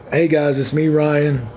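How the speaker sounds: ordinary delivery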